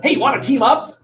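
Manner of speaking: eager coworker. Retold or imitated. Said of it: "that guy offers his help".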